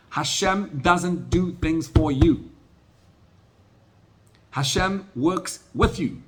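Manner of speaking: slow rising sermon cadence building to a climax; preaching at full force. Acted. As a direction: drive each phrase home like from the pulpit. Emphatic preaching on how Hashem works.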